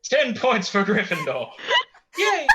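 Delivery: mock Hogwarts teacher. Player jokingly awarding points to a friend, cheery and high.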